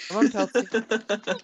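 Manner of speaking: high, slow coaxing call to a dog; calling a pet. Pretend. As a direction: coax him over like a puppy. Calling the new player like a pet.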